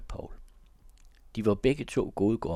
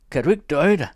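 higher and much louder angry snap; flaring up; 8 semitones higher, 11 dB louder, faster than usual. A narrator's reading. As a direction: snap at him, furious and shrill. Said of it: "sagde hun opfarende".

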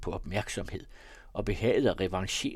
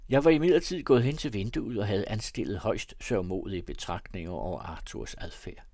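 usual speaking voice

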